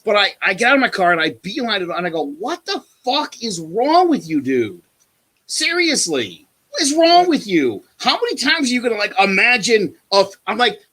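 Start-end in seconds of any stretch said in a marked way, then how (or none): 2.2-10.3 raised, incredulous angry pitch; exasperated high-pitched telling-off; retold screaming rant. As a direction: re-enact chewing someone out. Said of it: "I'm screaming this".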